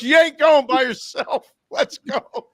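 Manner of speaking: very high, slow, loud insistence; hammed-up wife laying down the law; shrieky, drawn-out refusal; indignant mock wife. Wife insists on coming along.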